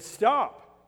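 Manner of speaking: sudden loud high stop; commanding shout. Acted. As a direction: halt them with one sharp shouted word. What a prophet should shout.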